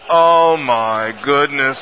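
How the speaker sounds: low, slow dismayed groan of dread; a touch of horrified disbelief. Retold or imitated. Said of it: "And I'm like".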